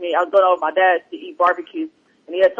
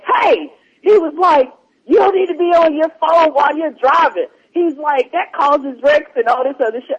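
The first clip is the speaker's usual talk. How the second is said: a higher, preachy voice scolding from the next lane; scolding stranger; 8 semitones higher than usual. Retold or imitated. Stranger in a truck scolding her at a stoplight, preachy and higher. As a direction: lecture her like a self-righteous busybody.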